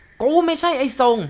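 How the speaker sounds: gruff snarl. A narrator's reading. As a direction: bark it as the angry ghost. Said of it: "เสียงห้าวใหญ่ตะคอกทันที".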